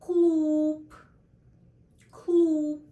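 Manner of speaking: Клуб said with a clear Russian U; Клуб with a clean, rounded U. Shown as a demonstration. Teacher gives learners an example of the vowel U.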